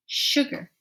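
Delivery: "sugar" with a lengthened sh at the start; stressed first sound. Shown as a demonstration. Teacher pointing out the digraph, "sugar" with a drawn-out sh. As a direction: lean on the "sh" of "sugar". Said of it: "I hear sh at the beginning".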